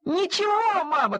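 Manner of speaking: duckling shouting high; shouted reply. A narrator's reading. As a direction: yell back to mom without a care. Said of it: "крикнул Тим".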